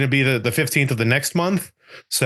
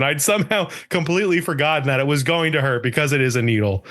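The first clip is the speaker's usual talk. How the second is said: usual speaking voice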